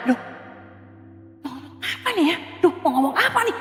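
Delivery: hushed, flustered mutter to herself; a nervous aside, barely voiced; panicked inner murmur. Preacher stuck at the pulpit, muttering in mild panic.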